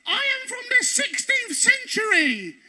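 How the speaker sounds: high, slow theatrical announcement; mimicking the actress. Actress greeting the group, high hammed-up announcement. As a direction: ham up the costumed actress's grand line.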